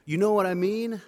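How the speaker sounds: loud, high and pushy. Retold or imitated. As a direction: throw it over the shoulder, insisting. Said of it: "a little more emphatic".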